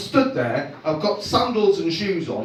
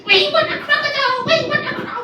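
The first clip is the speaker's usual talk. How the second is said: put-on high, chanting kid voice begging for a toy; whiny kids; faster than usual. Children pestering dad for a toy crocodile, high whiny chant.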